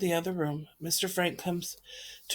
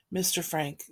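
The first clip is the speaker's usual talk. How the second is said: usual speaking voice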